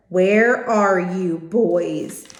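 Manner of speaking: slow, loud booming call; booming father. A narrator's reading. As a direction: stretch every word out like thunder. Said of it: "My father's voice was booming".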